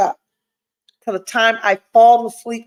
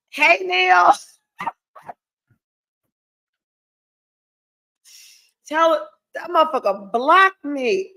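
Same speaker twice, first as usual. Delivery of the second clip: higher, playful call across; high teasing call; 6 semitones higher than usual. Real, not acted. Vlogger calls to Neil for backup, high playful call.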